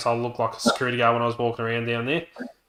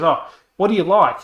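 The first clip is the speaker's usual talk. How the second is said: higher, louder mock sales interest; imitated saleslady; 6 semitones higher, 7 dB louder than usual. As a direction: eagerly probe the customer, mimicked.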